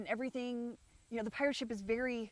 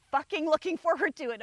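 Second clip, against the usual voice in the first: louder and higher on fucking looking forward; emphatic; 7 semitones higher, 9 dB louder than usual. Real, not acted. To viewers, dreading the move back, emphatic outburst. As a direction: spit out the dread of it.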